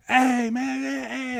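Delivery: high, loud calls for attention; calling out. Retold or imitated. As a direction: hail him from across the street. Young guys calling out.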